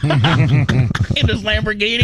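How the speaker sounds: amused high question through a laugh. Real, not acted. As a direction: laugh it out, teasing. Joking about a rich old client.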